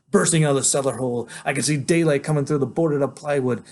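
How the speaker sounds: usual speaking voice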